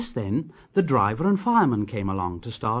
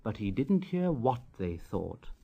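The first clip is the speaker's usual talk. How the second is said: softer and lower, drifting off; fading sleepy close; 3 semitones lower, 9 dB quieter than usual. A narrator's reading. Narrator ending bedtime story, fading softer and lower. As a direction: let the voice fade to sleep.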